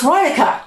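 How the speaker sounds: Reinica as RYE-nih-kah; correct pronunciation. Shown as a demonstration. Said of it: "You will hear people say Reineke".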